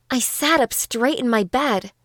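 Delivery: plain reading voice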